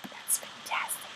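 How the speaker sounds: a soft whispered "that's fantastic" to herself; whispered delight. Real, not acted. Designer admiring her own cover, whispering to herself. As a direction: breathe it out, pleased, under your breath.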